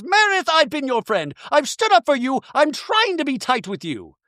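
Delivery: high, hammed-up appeal; pleading Bronwyn. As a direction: imitate a wronged friend pleading. Plays Bronwyn pleading in quarrel.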